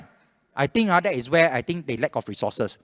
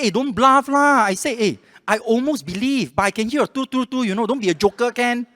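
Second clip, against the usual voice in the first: high-pitched, exasperated telling-off; scolding; 10 semitones higher, 5 dB louder than usual. Retold or imitated. Caller exposing the scammer's fake transfer, scolding. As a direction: call out the bluff in an acted scolding. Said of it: "Then I scolded him".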